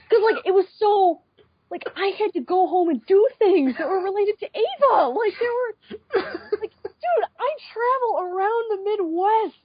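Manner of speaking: high, strained, almost wailing; exasperated rant. Real, not acted. Telling friends about her Ava credentials, high and exasperated. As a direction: vent how absurd it was.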